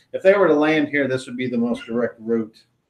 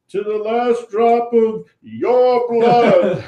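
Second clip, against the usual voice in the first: high, loud, drawn-out dragon boom; 11 semitones higher, 7 dB louder, slower than usual. Acted. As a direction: boom it out, slowing at the end. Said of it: "the booming voice of the dragon".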